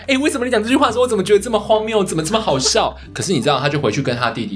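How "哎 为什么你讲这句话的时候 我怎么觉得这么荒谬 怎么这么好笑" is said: much higher, louder, laughing while asking; tickled and incredulous; laughing quote. Retold or imitated. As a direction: classmate giggling at the absurd insult. Classmate cracking up at insult.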